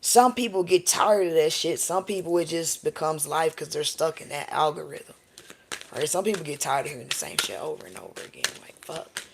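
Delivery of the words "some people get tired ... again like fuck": fed-up whisper. Retold or imitated. Mocking tired listeners.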